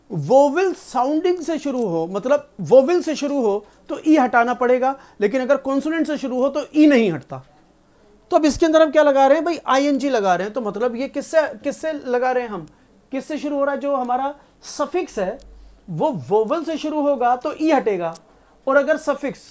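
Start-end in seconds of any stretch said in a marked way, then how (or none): none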